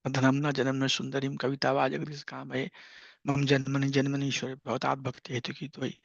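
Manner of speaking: quick, soft Sanskrit recitation; verse recitation. Acted. Devotee addresses the Lord, soft quick recital. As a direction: recite the prayer softly and evenly.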